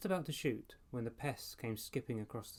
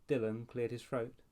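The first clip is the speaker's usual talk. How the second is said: ordinary delivery, nothing marked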